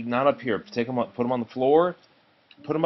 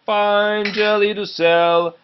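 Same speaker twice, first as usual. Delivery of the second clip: street vendor's call; 9 semitones higher, 5 dB louder, much slower than usual. Acted. Jelly seller calling in the street.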